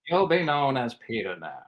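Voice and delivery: measured, solemn famous-actor imitation; Morgan Freeman. Star renames the speaker, measured and solemn. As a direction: pronounce it as a quiet naming. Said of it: "And then he goes".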